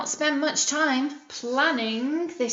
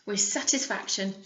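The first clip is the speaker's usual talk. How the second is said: ordinary delivery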